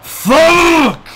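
high, loud drawn-out curse; real rage shout; furious yell. Real, not acted. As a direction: explode in frustration on one long word. Rage outburst at losing in game.

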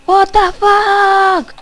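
loud, drawn-out, slow stunned cry; shocked outburst. Real, not acted. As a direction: yell it out in disbelief. Shocked reaction while gaming. (grito de sorpresa lento y fuerte)

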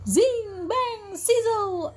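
high, stretched-out magic words; storybook spell effects; magical exclamation. A narrator's reading. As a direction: draw out each sound word with wonder. Magic transformation sound words.

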